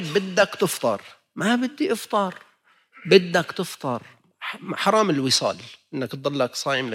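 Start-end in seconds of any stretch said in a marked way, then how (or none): 0.0-4.0 firm insistence. Retold or imitated. Islam insisting on breaking fast.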